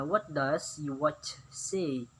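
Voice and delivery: drawn-out teacher model question; very slow model sentence. Shown as a demonstration. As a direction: stretch each word for learners. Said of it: "could you tell me the time". (pelan sekali seperti guru)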